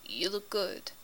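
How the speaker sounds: soft, hushed sincerity; low voice. A narrator's reading. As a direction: say it quietly and low. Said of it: "voice low".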